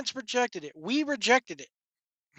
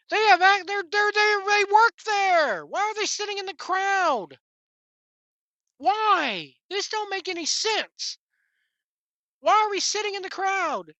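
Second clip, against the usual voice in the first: high-pitched exasperated disbelief; incredulous rant; 10 semitones higher than usual. Real, not acted. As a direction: pitch climbs as the outrage boils over. Ranting about wrestlers in crowd.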